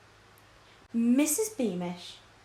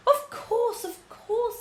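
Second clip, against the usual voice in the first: oily reassurance; 14 semitones higher, slower than usual. Acted. Villain soothing grieving widow.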